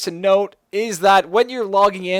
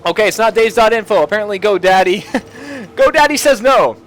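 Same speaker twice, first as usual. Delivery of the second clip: amused laughing; 4 semitones higher, 5 dB louder than usual. Real, not acted. Site lookup fails, amused.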